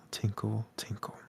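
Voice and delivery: "tinkle, tinkle" fading to a whisper; "tinkle" dropping to a faint breath and vanishing. A narrator's reading. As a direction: let the bell die away, whispering "tinkle". Bell sound fading away.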